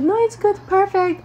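very high, loud mock reply; reassuring customer's reply. Rapper to the worried speaker, exaggerated reassurance. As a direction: imitate him in a squeaky-high voice.

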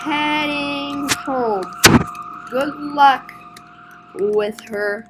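slow, stretched-out farewell; slow word by word. Acted. Leaving the officer with the customer, slowly. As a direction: draw out the words with pauses.

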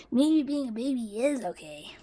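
low, hushed admission; soft conceding murmur; private aside. Acted. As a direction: whisper it to yourself.

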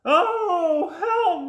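very high, wailing cry for help; hammed-up falsetto moan; mock moaning. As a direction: wail like a frightened cartoon fish. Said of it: "moaned the mackerel".

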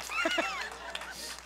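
a big high-pitched laugh bursting out. Real, not acted. Preacher laughing at his first-class upgrade story, big high laugh.

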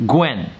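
'Gwen' in a short, sharp fall; exact fourth tone. Shown as a demonstration. Teacher modelling the word for repetition, exact falling tone. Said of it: "with that exact tone".

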